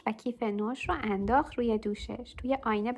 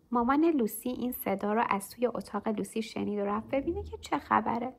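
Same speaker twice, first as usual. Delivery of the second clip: plain reading voice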